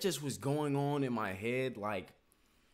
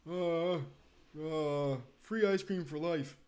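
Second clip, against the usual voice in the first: mock Biden; 2 semitones higher, slower than usual. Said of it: "This is Biden".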